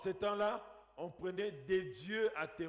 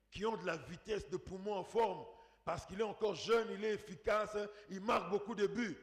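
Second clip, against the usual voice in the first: ordinary talk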